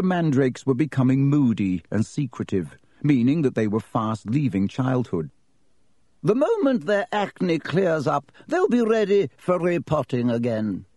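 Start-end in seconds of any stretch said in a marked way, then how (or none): 6.2-10.8 kindly reassuring nurse. Acted. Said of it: "telling Filch kindly".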